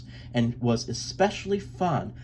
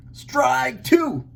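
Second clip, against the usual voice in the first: umpire call; 12 semitones higher, 4 dB louder than usual. A narrator's reading. Umpire calls the pitch.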